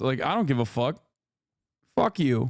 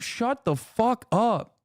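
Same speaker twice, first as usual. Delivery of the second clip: slow, higher, sneering; mocking slow put-down; drawn-out sneer; 6 semitones higher, slower than usual. Real, not acted. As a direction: stretch it out in contempt. Mocking Don Junior.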